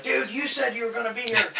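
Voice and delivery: high, loud mock outrage; losing his mind. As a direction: imitate an irate customer's protest. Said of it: "he loses his mind".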